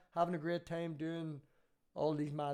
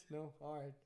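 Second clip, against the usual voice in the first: normal talking voice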